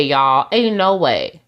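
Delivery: ordinary talk, nothing marked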